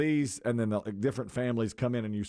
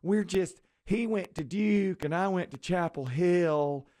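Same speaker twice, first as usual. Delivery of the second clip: mocking an actress's fake Southern accent; 6 semitones higher than usual. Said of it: "it was a fake Southern accent".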